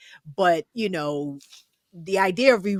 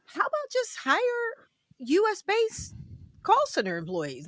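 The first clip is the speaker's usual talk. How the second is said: incredulous suggestion; 13 semitones higher than usual. Acted. Sarcastic high suggestion to the companies. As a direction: act out the obvious fix for the company.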